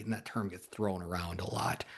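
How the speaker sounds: hushed aside; whisper. Real, not acted. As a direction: drop to a breathy whisper. Aside about the micromanager label.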